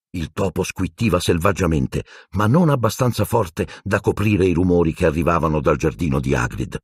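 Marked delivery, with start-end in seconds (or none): none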